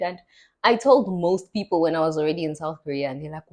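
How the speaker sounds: usual speaking voice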